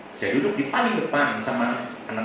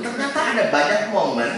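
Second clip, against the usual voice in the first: ordinary delivery, nothing marked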